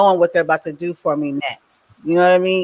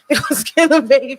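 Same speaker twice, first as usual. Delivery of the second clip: higher, laughing through the staff's warning; laughing mock quote; 7 semitones higher than usual. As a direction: repeat the warning, laughing at how absurd it sounds. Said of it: "She's like".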